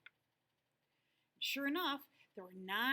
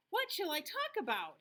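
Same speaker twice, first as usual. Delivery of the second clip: eager, bright question; 7 semitones higher, 4 dB louder than usual. Acted. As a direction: ask it keenly like a proud little rabbit. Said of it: "he asked eagerly".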